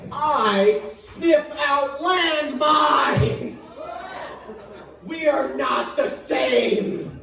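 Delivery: proud boast. Pretend. Dog boasting to chihuahuas.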